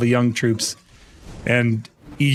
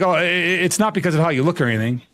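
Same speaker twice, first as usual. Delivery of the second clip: stammered start, then a rushed excuse; imitating a flustered stutterer; stuttering; 2 semitones higher, faster than usual. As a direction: trip over the first word, then hurry it out. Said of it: "he starts stuttering".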